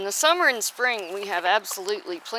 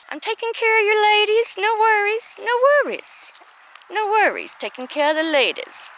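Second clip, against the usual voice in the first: high, louder sing-song reassurance; pet talk; 11 semitones higher, 4 dB louder than usual. Real, not acted. Reassuring the rooster about his hens, high coo. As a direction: soothe the rooster in a squeaky voice.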